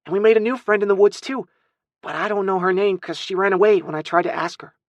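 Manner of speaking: put-on high, eager child explaining; little boy. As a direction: rush out an excuse as a small boy. Small boy explains himself.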